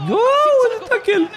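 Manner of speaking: amazed outburst. Real, not acted. Wild tackle in the match.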